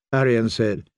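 ordinary delivery, nothing marked

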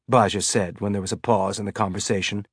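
ordinary delivery, nothing marked

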